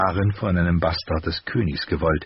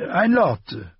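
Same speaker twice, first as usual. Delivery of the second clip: higher, hesitant armourer voice; hesitant master; 12 semitones higher, 4 dB louder than usual. A narrator's reading. Armourer reluctantly answering Ned, higher voice. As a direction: admit it reluctantly. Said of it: "sagte der Meister zögerlich".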